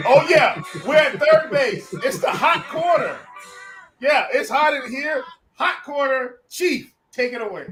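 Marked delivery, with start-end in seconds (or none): none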